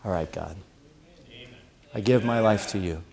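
hushed, low surrender to God; barely voiced, giving in; quiet prayer. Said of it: "what God is calling you to do is just go".

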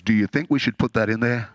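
lightly voiced God, low pitch; low-pitched, casual question as a hint of God; God consulting angels. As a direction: play God lightly, dropping the voice. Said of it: "vision of God saying to the angels".

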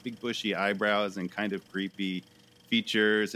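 usual speaking voice

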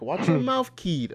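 mocking "keed" stretched to an ee sound; mock Connor taunt. Connor taunting the manager, "keed" with a long ee. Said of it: "calls him a keed with a E".

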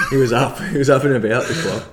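real laughter through the words; laughing. Real, not acted. Recalling a friend's celebration with a teammate, laughing. Laughing along at the retold story.

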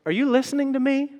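high, quick put-on wife voice, pointed; husband caught not listening, wife's high, quick jab; nagging wife. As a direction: play the wife snapping a pointed question at her husband. Wife nagging husband, acted quote.

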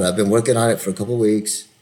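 ordinary talk

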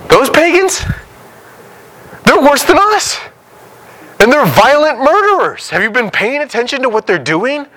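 appalled Habakkuk. Retold or imitated. Habakkuk protesting to God about the Chaldeans, high and incredulous. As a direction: squeal it out in outraged disbelief, way up high. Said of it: "He's like".

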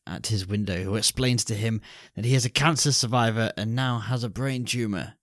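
ordinary talk

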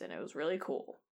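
low, quiet, barely noticeable sarcasm; deadpan sarcasm. Acted. Telling the party about being picked up in space, deadpan sarcasm. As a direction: say it softly with hardly any sarcastic inflection. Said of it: "I mean that sarcastically".

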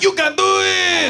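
high, loud, slow mock cheer; root him on like the Water Boy's mom. Viewer cheering on the president at home, hammed-up high shout. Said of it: "you're like".

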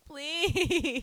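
high, loud plea through laughter; laughing plea. Retold or imitated. New nurse begging kindness on day one, laughing. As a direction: beg while laughing. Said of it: "I'm like".

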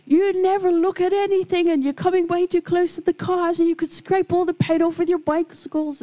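high-pitched mock screaming rant; exploding neighbour. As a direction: imitate an irate neighbour scolding children without a breath. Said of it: "and she exploded".

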